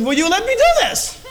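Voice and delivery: high, loud mock outburst; exasperated yell. Father retelling his outburst at his teen, exasperated. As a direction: yell it in comic frustration. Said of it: "I said".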